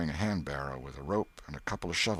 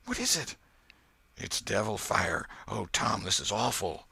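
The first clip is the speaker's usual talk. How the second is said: awestruck fear; 4 semitones higher than usual. A narrator's reading. Boys see devil fire.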